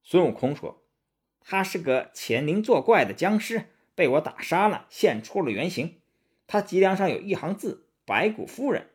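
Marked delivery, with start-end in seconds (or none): 1.4-8.9 high-pitched, animated monkey voice; Sun Wukong explaining. Acted. Sun Wukong proves to master it was a demon, high eager voice.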